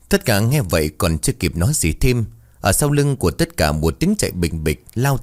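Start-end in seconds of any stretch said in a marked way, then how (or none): none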